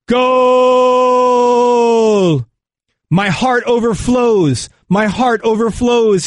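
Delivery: high, drawn-out goal call; bellowing. A narrator's reading. Radio commentator calling a goal to the fans, bellowing. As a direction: bellow it like a soccer commentator overcome with joy. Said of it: "and he bellows".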